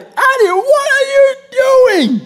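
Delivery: high loud startled yell; yelling at daughter. Retold or imitated. Father yelling at toddler in bathroom, startled yell. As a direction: yell her name in shock. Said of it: "I probably was yelling".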